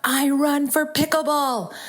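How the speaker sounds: high, drawn-out excited cry; triumphant yell. Retold or imitated. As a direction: sing it out proudly as you arrive. Calling out to friends while arriving.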